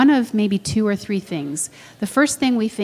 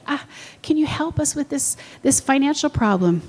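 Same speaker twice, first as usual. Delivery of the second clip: pleading new believer; 6 semitones higher than usual. Acted. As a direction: ask for help like a needy beginner. Voices new believers pleading in prayer.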